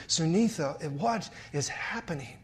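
a father coaxing his crying daughter; gentle father. Acted. Father asks his crying daughter gently, softly.

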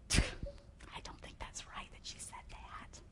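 hushed, slow disapproving whisper; mock murmur of disapproval, barely voiced; gossiping whisper. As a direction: whisper it like a scandalized churchgoer behind your back. Voicing disapproving church gossips.

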